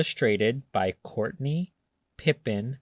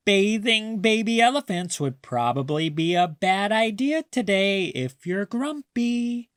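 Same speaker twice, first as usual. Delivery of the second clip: higher, quick, teasing grandma; Grandma's playful voice; 8 semitones higher than usual. A narrator's reading. Grandma teasing grumpy Maya, high and quick. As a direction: rattle it off like a sly grandma.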